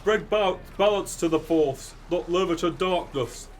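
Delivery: high, loud comic lament; a daft British rendition of the grief speech; Obi-Wan as Brian Butterfield. Retold or imitated. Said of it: "Obi-Wan impression ... as Brian Butterfield".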